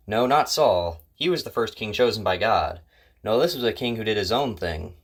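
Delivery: ordinary delivery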